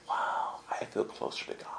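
hushed, mock-reverent whisper; awed whisper. Tourist touching a shiny souvenir cross, awed whisper. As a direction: whisper it as if touched by something holy.

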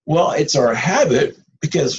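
normal talking voice

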